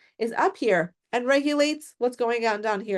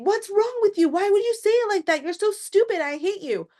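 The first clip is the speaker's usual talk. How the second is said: much higher, hurt and angry outburst; reactive lashing out; 9 semitones higher than usual. Retold or imitated. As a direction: hammer them with the rebuke like you're flipping out. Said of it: "Rather than saying".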